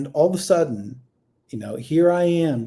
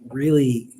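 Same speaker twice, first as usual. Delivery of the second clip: normal talking voice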